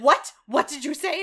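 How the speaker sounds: loud, shocked what did you say. Retold or imitated. Rumple, told to grab a cow, reacts loud and shocked. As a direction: blurt it out in disbelief. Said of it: "Rumple's like".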